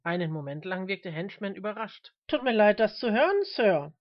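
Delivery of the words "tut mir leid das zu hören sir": loud public reply; projected preacher's reply. A narrator's reading. Preacher answers a heckler aloud.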